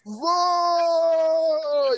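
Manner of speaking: a high, loud, held "Vou!"; defiant shout. Retold or imitated. Surfer answers the warning with a high defiant yell. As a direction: shout it back, committing to the wave. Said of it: "E eu falei".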